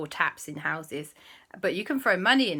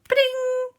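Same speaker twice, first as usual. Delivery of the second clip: very high ringing ding; cartoon ding; 6 dB louder than usual. Christopher wishing, very high ringing ding. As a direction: chime like a magic bell. Magic sound effect.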